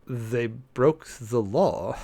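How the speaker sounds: each word dragged out flat and final; slow, exasperated deadpan. Real, not acted. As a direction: spell it out slowly like it's obvious. Deadpan rebuttal to the order.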